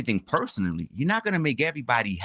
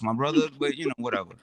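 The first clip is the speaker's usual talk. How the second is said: ordinary talk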